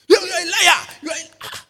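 very high, loud accusing shout; hammed-up high-pitched yelling; mock angry accusation. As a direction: imitate a furious man yelling an accusation. Acting out man's accusation in quarrel.

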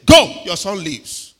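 loud, slow and firm command; booming faith command; forceful command. Shown as a demonstration. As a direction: declare it with full authority. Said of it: "He didn't say that mildly".

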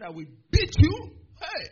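loud acted threat. Big student threatening him after pulling him aside, loud mock threat. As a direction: bellow it as the bully.

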